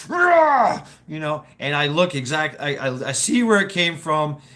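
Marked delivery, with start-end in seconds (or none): 0.1-0.8 high, loud burst of a roar; angry roar. Retold or imitated. Driver roaring at the shoe, angry roar. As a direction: let out a sudden furious roar. Startled by shoe hitting car.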